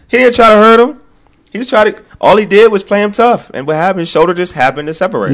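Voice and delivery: high, loud sarcastic rant; mock the injury claim loudly; sarcastic outburst. Real, not acted. Sarcastic rant about Kevin Love.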